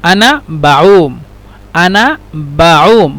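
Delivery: আনা বাউম drawn out very slowly, syllable by syllable; slow demo. Shown as a demonstration. Teacher repeating the Arabic phrase for viewers, very slow. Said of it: "তো আর এটা হলো আনা বাউম".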